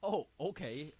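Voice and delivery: sarcastic concession; mock-agreeable, lightly sarcastic. Real, not acted. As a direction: give in while plainly not meaning it. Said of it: "did you say it sarcastically?".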